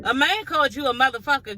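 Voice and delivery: ordinary talk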